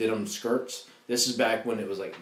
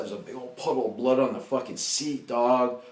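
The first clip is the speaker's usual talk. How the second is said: ordinary talk, nothing marked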